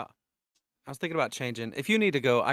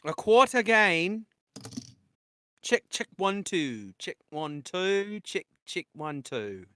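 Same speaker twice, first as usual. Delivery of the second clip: put-on high, slow Australian accent; mic check in an Australian accent; 7 semitones higher, 5 dB louder, slower than usual. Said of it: "I'm louder in my Australian accent".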